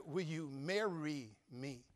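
"Will you marry me?" drawn out slowly and evenly; slow earnest proposal. Shown as a demonstration. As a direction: spell out the proposal slowly so she can't miss it. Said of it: "No, no, I said".

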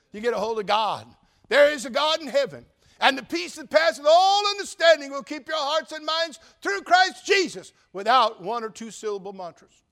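a pastor shouting scripture at the climax; preaching at full force. Real, not acted. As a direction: build to a thundering proclamation.

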